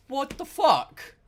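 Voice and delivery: high, slow, loud disbelief; incredulous outburst. Retold or imitated. Worker answering a coworker's assumption, incredulous outburst.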